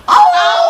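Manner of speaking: high shouted howl. Acted. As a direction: howl like a wounded animal. Beasts howling in pain.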